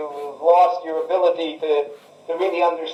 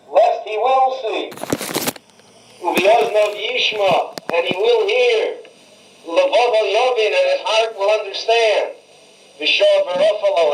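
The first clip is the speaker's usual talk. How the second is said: sarcastic reading; 5 semitones higher than usual. Retold or imitated. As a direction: read the verse with ironic lift. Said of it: "that's the sarcasm".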